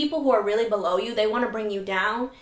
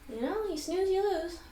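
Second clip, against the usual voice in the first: taunting; 9 semitones higher, 7 dB quieter than usual. Retold or imitated. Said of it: "so satisfying to be like".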